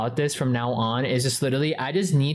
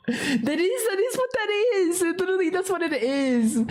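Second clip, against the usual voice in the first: much higher, laughing exasperation; high pitched insistence. Real, not acted. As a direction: squeal it out, half laughing. Insisting about server name.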